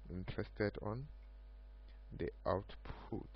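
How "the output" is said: ordinary talk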